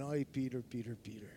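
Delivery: soft, low, fading head-shake sigh of a name; tender chiding. Pretend. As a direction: gently scold him with love, almost under breath. Jesus shaking his head lovingly.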